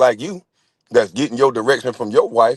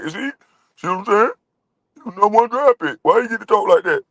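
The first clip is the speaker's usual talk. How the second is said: high nasal-free mock whine of a critic, pitched way up; 8 semitones higher than usual. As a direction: sneer at the critics in a high put-on voice. Mocking critics again.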